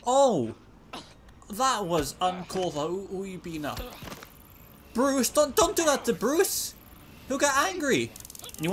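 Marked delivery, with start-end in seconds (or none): 0.1-0.6 sudden loud startled cry; jolted by a shock. Real, not acted. Player jumps at a surprise, startled cry. 4.9-8.1 high, loud mock panic; mock alarmed plea. Real, not acted. Player begs the game to spare Bruce, high mock alarm.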